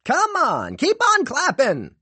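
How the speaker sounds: very high, loud cheer; shouting kid. A narrator's reading. Ollie shouting to the crowd, high excited kid. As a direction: pump up the crowd. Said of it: "Ollie shouted to the crowd".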